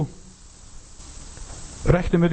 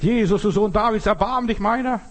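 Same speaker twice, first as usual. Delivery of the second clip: raised, urgent pleading call; imitated cry for mercy; 8 semitones higher than usual. Blind Bartimäus calls to Jesus for mercy, high pleading cry. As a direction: call out high without real shouting. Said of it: "er schreit".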